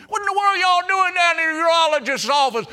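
high, fast, full-voice shout; loud incredulous question. Acted. Onlooker questioning singers at urologist's office, loud incredulous shout. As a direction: demand it at the top of the voice.

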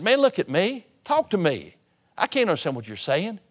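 a preacher retelling losing his temper; in the flesh. Retold or imitated. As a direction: snap at him to look up and talk. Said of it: "kinda got in the flesh".

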